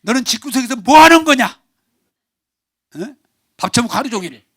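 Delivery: loud, high berating husband; husband scolding. Retold or imitated. Husband berating his wife all day, loud and high. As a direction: yell at the wife as a bossy husband. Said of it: "남편이 ... 아내를 맨날 혼냅니다".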